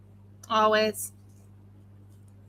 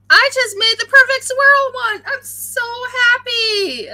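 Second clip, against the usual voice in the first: excited; 10 semitones higher, 7 dB louder than usual. Real, not acted. Made the perfect swirl.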